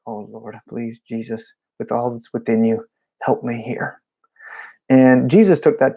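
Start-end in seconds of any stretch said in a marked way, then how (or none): 0.1-4.0 soft desperate plea; whispered prayer. Retold or imitated. Drummer praying quietly before the seven punches. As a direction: pray under your breath before the punches. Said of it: "And I'm like".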